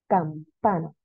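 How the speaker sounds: Campana broken as cam, PA-na; stress on penultimate syllable. Shown as a demonstration. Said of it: "Tiene el acento en las penúltimas sílabas".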